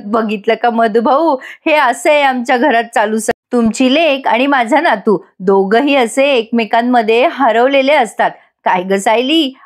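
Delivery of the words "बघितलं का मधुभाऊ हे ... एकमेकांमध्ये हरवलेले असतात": ordinary delivery, nothing marked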